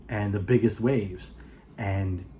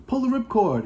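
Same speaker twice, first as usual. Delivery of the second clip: mock yelled command; 11 semitones higher than usual. Gun to head, freefall demand.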